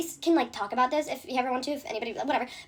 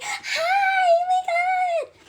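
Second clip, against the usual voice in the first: high, loud gushing mock squeal; fake excited squeal; 6 dB louder, much slower than usual. Mocking the friend's fake delight on Bryce's call, shrill squeal. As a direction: mimic her sudden sugary phone voice. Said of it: "she's like".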